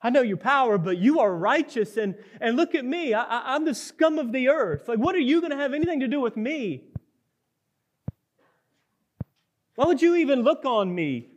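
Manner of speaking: high, loud, rushing self-abasing plea; desperate leper. Acted. Leper tells Jesus he is scum, high anguished plea. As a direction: pour out his shame in a high cry. Leper pleads his unworthiness.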